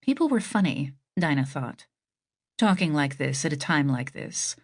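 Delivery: plain reading voice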